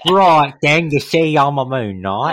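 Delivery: loud, high Cockney through a laugh; hammed-up Cockney Moon Knight voice, slowing at the end; laughing mock Cockney; mock Cockney accent.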